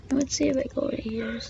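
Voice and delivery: fast unvoiced whisper; quick hushed aside to yourself. Real, not acted. Player sneaking to a new spot, quick whisper.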